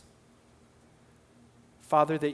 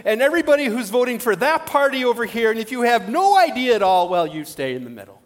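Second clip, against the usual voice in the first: louder, higher pretend command; mock announcement; 6 semitones higher, 7 dB louder than usual. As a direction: play the pastor sorting the room. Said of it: "What if I said".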